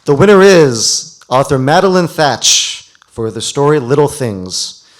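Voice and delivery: big, loud reveal with a slow finish on the title; excited announcement. Real, not acted. Host revealing the winner to the audience, excited and loud. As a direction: announce the winner like a prize reveal, stretching the last words.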